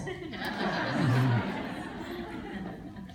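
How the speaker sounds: long laugh at the joke. Real, not acted. [name] laughing at Luis's herbals. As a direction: laugh it out, trailing off.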